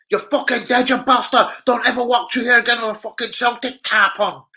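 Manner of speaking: high, loud mock Scottish threat. Rangers boy threatening the Celtic fan, loud mock Scottish threat. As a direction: threaten like a hard Glasgow kid. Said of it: "You see, you, you fucking Fenian".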